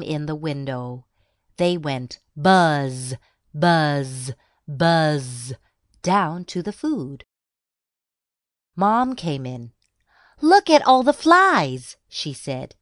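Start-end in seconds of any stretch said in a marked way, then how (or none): 2.4-5.5 drawn-out buzz, slow and even; imitated fly buzz. A narrator's reading. Narrator imitates flies buzzing. 10.4-12.0 high, loud exclamation; exclaiming mom. A narrator's reading.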